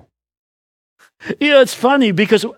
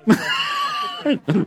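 big laugh; 7 dB quieter than usual. Real, not acted. Preacher laughs at own joke.

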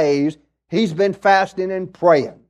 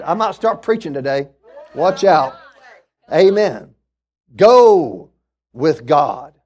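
normal talking voice

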